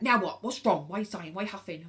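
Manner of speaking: loud, irritated nagging husband; husband firing off loud questions; exasperated husband. Retold or imitated. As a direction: snap at her sighing. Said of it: "he'll be like".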